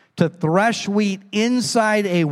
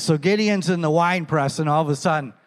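ordinary talk

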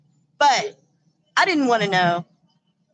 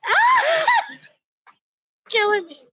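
high squeal then breathless laughing; helpless laughter easing down; much slower than usual. Real, not acted.

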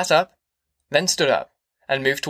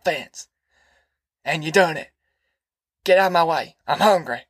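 put-on raised, rough old man talking fast about jail; old Grandpa voice; 5 semitones higher than usual. A narrator's reading. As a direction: play a boastful old grandpa ranting.

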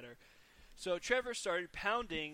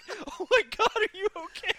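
high, loud, laughing through oh my God; laughing retold alarm; mock concern; 11 dB louder than usual. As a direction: pretend worry while cracking up. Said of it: "I'm like".

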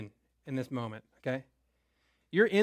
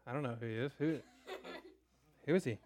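hushed, much softer and lower, playing dumb; feigned innocence; 5 semitones lower, 13 dB quieter, much faster than usual. Haman hiding his guilt from the king, hushed and low. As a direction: shrink back and pretend you know nothing. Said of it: "you're just like".

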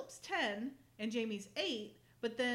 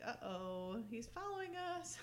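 mock worry; 2 semitones higher, 5 dB quieter than usual. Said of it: "looking back like".

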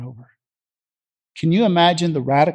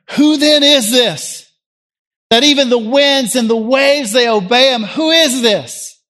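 high, loud, alarmed cry; terrified awe; 8 semitones higher, 7 dB louder than usual. Acted. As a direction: cry out in stunned fear, drawing out the question. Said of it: "And they were terrified".

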